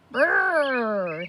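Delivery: a lip-buzzing tractor rumble; tractor engine noise. A narrator's reading. Boy playing with toy tractor, buzzing engine noise. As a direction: make the tractor sound. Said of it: "he said to himself".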